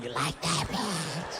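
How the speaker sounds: hushed demonic dirty talk; little demon. Retold or imitated. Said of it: "Sounded like a little demon".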